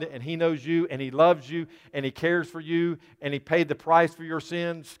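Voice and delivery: ordinary delivery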